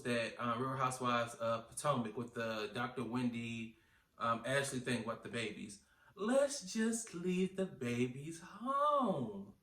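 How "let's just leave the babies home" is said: slow, high singsong suggestion; exaggerated plea. Telling castmates about babies, slow singsong plea. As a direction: draw it out sweetly slow, swooping in pitch.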